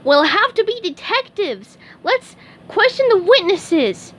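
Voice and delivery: very high, eager cartoon sponge voice; high squeaky sponge voice; SpongeBob voice. A narrator's reading. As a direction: chirp it like a keen little fry cook. SpongeBob speaks.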